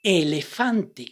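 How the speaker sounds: "Elefante" slowly, every vowel clear; slow syllable reading. Shown as a demonstration. Teacher reading "Elefante" slowly for students. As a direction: read "Elefante" slow and even. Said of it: "Now let's read this word".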